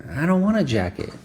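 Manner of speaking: put-on high, pouting child refusing; whiny kid.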